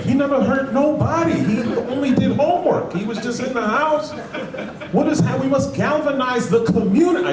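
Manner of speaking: very high-pitched mock community voice; outraged community member. Neighbor defends slain student to the world, high mock outcry. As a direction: plead for him in a squealing, hammed-up neighbor voice. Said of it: "the community was like".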